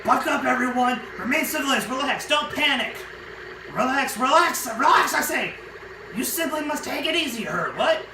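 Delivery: mock frantic calm. [name] shouts at the crew to relax, frantic. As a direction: shout for calm while losing it.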